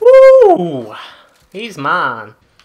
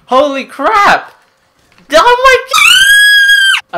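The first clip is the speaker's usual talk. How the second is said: excited shout; 13 dB louder than usual. Real, not acted. Gamer reacts to wild kill.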